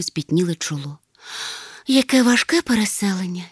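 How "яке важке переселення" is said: ordinary delivery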